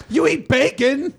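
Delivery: high, slow, drawn-out disbelief; incredulous challenge. Retold or imitated. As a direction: call out grandma's excuse in a high stretched voice. Retold challenge to grandma's excuse.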